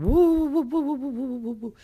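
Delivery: high, loud, wavering wind howl. Acted. Storyteller imitates a lot of wind, howling. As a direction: whoop like gusts, fading off. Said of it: "the winds were howling".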